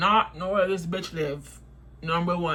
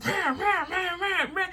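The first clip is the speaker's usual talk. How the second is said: mock ranting; 8 semitones higher, 4 dB quieter than usual. Girl mimicked ranting.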